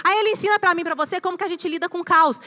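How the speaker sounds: ordinary talk, nothing marked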